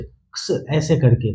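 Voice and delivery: "क्स" said as one soft cluster; half k plus s. Shown as a demonstration. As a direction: clip the k and glide into s, slowly. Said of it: "आधा क और स से".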